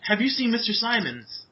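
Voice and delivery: high, insistent plea; urgent little girl. Acted. Lily asks the shadow after Mister Simons, urgently. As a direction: ask eagerly in a high girlish voice.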